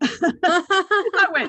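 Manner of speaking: bursting into a loud, high laugh. Real, not acted. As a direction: laugh out the shock before speaking. Shocked by mother's answer.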